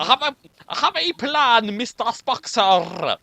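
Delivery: second try at a Scottish engineer; thick Scottish accent. Acted. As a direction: roll the R like Scotty. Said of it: "Yeah, that was good".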